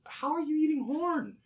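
high, loud, baffled; incredulous question. Retold or imitated. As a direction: ask in disbelief how anyone eats horn. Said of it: "I kept on questioning".

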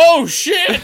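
high, loud "oh shit"; mock startled. Old man losing his cane, high startled yelp. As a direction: yelp it like a clumsy idiot. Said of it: "And he's like".

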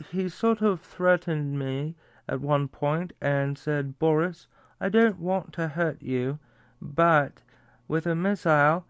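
put-on light British accent, quoting Johnson. Host quoting Johnson on Putin's threat, light British accent. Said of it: "I did the British".

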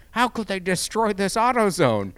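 high, loud mock pearl-clutching; shrill-free hammed-up outraged citizen; mocking outrage.

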